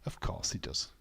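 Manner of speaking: whisper. Real, not acted.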